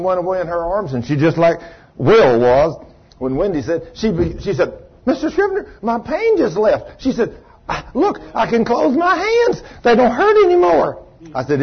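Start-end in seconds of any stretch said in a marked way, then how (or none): 5.1-11.0 very high, astonished woman's voice; amazed old woman. Retold or imitated. Edith telling the preacher her pain left, amazed and high. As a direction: squeal it out in wonder.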